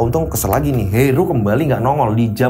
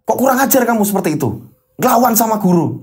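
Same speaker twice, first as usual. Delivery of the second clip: scolding teacher; 6 semitones higher than usual. Acted. Teacher scolds insolent student.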